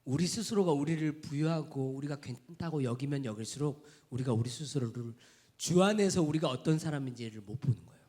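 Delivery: ordinary talk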